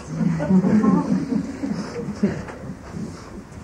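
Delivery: quiet breathy laugh; soft laugh. Real, not acted. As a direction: let a small laugh slip out. Reader laughs after moral.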